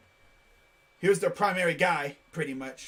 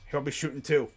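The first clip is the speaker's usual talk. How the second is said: normal talking voice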